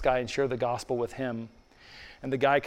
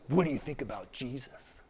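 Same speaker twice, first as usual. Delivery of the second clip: imitated street protester; 2 semitones lower, 8 dB quieter than usual. Protester quizzing him about Jesus, in a subdued put-on voice. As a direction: put on the protester's voice, much softer. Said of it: "Like this".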